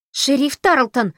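loud, high, drawn-out yell of a name. Acted. Audrey storms at the sheriff, shrieking loudly. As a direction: howl the sheriff's name in outrage. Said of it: "завопила она".

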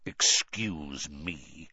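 low, slow, quiet growl. A narrator's reading. Moody cutting off Harry's self-doubt, growling low. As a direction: growl it with drawn-out menace. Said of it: "growled Moody".

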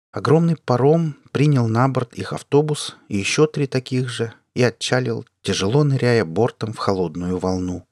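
usual speaking voice

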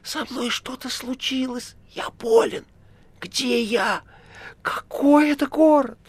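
high, wailing sobbing plea; desperate crying. A narrator's reading. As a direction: cry out lost and panicked. Styopa panicking, crying. (плач в отчаянии)

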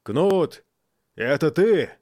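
loud, high calling out; loud and clear. Acted. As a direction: call to him across the pit. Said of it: "говорил громко и чётко".

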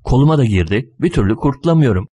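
ordinary delivery

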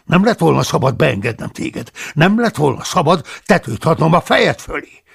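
loud reproach, rising in anger; angry scolding. Acted. The abbot loudly reproaches Paulus, angry. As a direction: berate the unwelcome monk.

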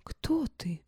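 whispered, timid and puzzled; bewildered whisper. A narrator's reading. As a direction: breathe it out in confusion. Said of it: "лепечет в недоумении".

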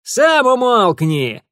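high, loud, slow snarl back; roaring retort. Acted. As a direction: bellow back at the neighbours. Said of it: "рявкнул в ответ Франт". (рявкнуть в ответ)

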